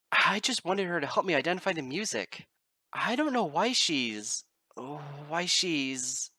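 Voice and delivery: high, loud, slowing and breaking off; panicked pleading. Acted. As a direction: lose the words in rising panic. Bard panics as room shakes.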